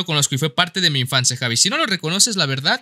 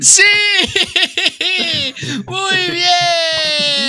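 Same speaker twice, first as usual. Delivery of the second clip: high, laughing celebration; elated, high-pitched whoop; jubilant cheer; 11 semitones higher, much slower than usual. Real, not acted. Celebrates the right guess.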